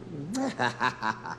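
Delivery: soft, low run of laughter; low chuckle. Real, not acted. Preacher pausing over the little child, quiet laugh. Laughs alone after a pause.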